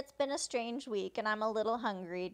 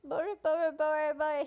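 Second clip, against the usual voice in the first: quick repeated stammer, slightly raised; mock stuttering; 4 semitones higher, 3 dB louder, faster than usual. Pierce trying to say Boris, stuttering. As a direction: imitate someone stuck on a name. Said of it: "He's trying to say Boris".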